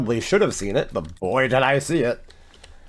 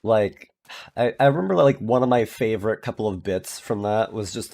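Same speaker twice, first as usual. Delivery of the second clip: usual speaking voice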